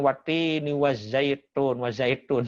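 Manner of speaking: slow mock Balinese "watini wazaitun". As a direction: read "wazaitun" with a Balinese t, slowly. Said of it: "huruf ta suka jadi ta". (logat Bali, "wazaitun" dibaca pelan)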